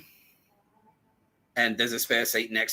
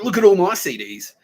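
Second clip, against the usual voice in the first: high, loud boastful imitation; mock show-off; 9 semitones higher, 9 dB louder than usual. Rival showing off his CDs, mock boasting. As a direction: show off your collection, mockingly.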